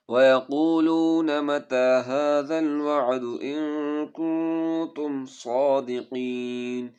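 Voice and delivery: melodic chanted tajweed recitation; Quran recitation. Shown as a demonstration. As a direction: recite slowly and musically, stretching vowels. Teacher recites Quran verses.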